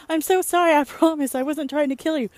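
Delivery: high, loud gushing apology; apologetic coworker impression. Tasha apologizing after nearly hitting her, high gushing apology. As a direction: play the flustered Australian coworker. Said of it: "She's like".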